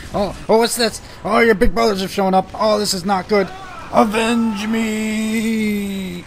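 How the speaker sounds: high, loud hammy panic; overacted death scene. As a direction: ham up a dying soldier's cries. Swarmed by monsters, hammy dying.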